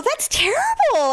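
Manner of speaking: very high, drawn-out sympathy; retold dismay. Patient reacting to hygienist's cancer news, high-pitched dismay. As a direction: exclaim it high and slow, acted out.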